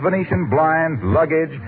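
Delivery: normal talking voice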